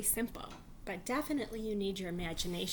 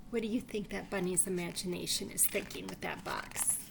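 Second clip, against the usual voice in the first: ordinary delivery, nothing marked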